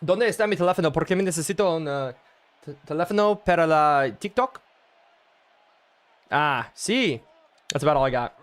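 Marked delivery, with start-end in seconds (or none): 0.0-7.2 clumsy Spanish. Pretend. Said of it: "Spanish that we can say".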